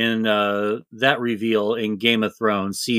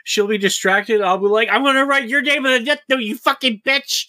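high, laughing hammed-up bravado; mock tough-guy Light; 10 semitones higher than usual. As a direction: ham up a cocky punk sure he can win. Voicing Light plotting to attack.

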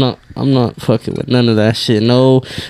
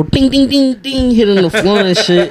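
coin ding imitation; 7 semitones higher than usual. Imitating coins hitting floor.

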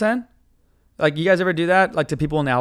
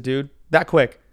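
ordinary talk

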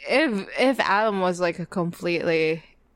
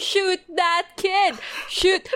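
higher, slow mock yell of glee; mock screaming; 9 semitones higher, slower than usual. Retelling cheering at the TV, a higher mock scream. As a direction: cheer him on like a gleeful fan, hammed-up. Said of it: "screaming, going".

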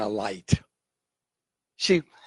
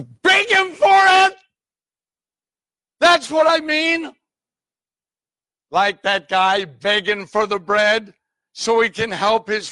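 high, loud, drawn-out pulpit shout; impassioned preaching shout; 8 semitones higher, 7 dB louder, slower than usual. Real, not acted. Preacher urging congregation to beg for the Spirit, impassioned shout. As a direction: cry it out at full force, urging them.

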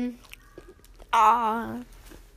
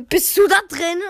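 imitating mom; 5 semitones higher, 6 dB louder than usual. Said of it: "sie klopft an mein Zimmer".

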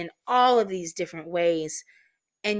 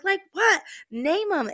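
high, challenging retort, acted; retold frustration; 13 semitones higher, 4 dB louder than usual. Snapping at the consoling friend, acted frustration. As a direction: fire back in exasperation, pitched way up. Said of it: "taking out all of my frustrations on her".

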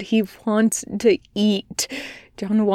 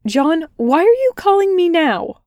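much higher, louder, anxious question; high worried voice; 8 semitones higher, 4 dB louder than usual. A narrator's reading. Therapist startled by her patient's late call, high and anxious. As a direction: pitch way up with worry. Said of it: "jumped almost an octave with worry".

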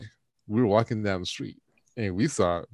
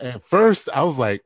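ordinary talk

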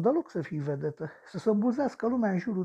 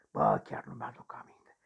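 breathy whisper, far lower; 4 dB quieter than usual. Acted. A killer whispering to a girl he can't remember. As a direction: drop to a hushed whisper, puzzled. Confused aside to a ghost.

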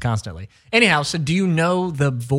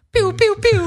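high playful pew pew; pew sound effect; 4 dB louder than usual. Pretend. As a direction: squeak out cash-gun pews. Mimicking throwing money.

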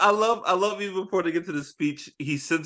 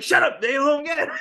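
high laughing shout; laughing mock shout; 11 semitones higher than usual. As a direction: yell it through a laugh. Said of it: "the movie's like".

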